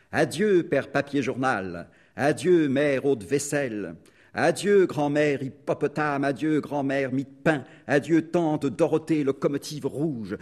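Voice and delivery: put-on a child's triumphant, ringing goodbyes; gleeful farewells. Boy crowing farewell to the relatives he slew, loud and gleeful.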